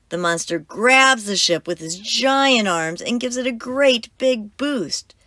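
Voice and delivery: ordinary delivery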